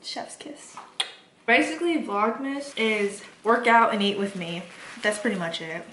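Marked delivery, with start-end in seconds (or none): 0.0-0.8 whispered. Real, not acted. Whispered aside over food.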